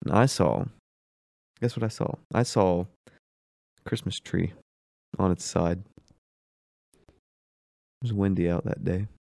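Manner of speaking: mock stand-up bit. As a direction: stretch out a deliberately weak joke with long pauses.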